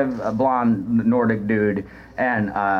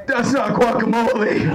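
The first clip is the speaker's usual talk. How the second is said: high, crying man's voice; blubbering giant; tearful quote; 9 semitones higher than usual. Retold or imitated. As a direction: quote him like a weepy kid. Greg crying at the buffet.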